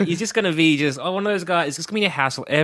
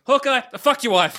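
higher, louder fed-up jab; mock outburst; 10 semitones higher, 3 dB louder than usual. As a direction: snap at him, half joking. Fed-up friend snapping at Hawkeye.

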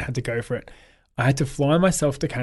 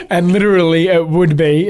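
loud, higher, talking through laughter; laughing; 5 semitones higher, 10 dB louder than usual. Real, not acted. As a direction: keep talking while cracking up. Still laughing at own joke.

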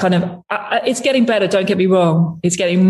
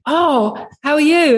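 higher-pitched, badly imitated singer's accent; bad [name] accent; 9 semitones higher, slower than usual. Woman imitating the singer greeting her, bad accent. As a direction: greet an acquaintance in a rough put-on accent. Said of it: "doing a really bad accent of you".

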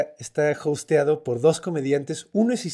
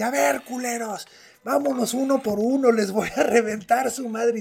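berserk rage; 7 semitones higher than usual. Retold or imitated. Skadi challenging gods one by one.

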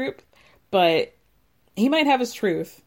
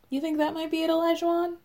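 high, soft, needling question; sarcastic questioning; 7 semitones higher, 5 dB quieter, faster than usual. Pretend. Podcaster needles the absent husband, sarcastic questioning. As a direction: feign a helpful suggestion, high-pitched.

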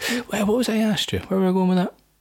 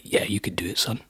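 imitating his mum in a soft whisper; 5 semitones lower, 5 dB quieter than usual. Son voicing his supportive mum, whispered. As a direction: breathe it like a doting mum. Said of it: "She's like".

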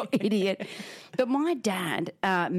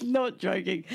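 normal talking voice

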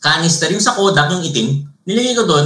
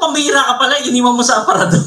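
mock disbelief; 10 semitones higher, faster than usual. Mock reply in retold quarrel.

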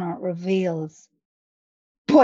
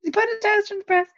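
fast incredulous; 10 semitones higher, much faster than usual. Real, not acted. Repeated incredulous question, fast.

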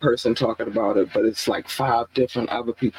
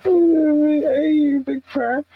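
stifled giggles; 4 dB louder, slower than usual. Real, not acted. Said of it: "I'm trying not to laugh".

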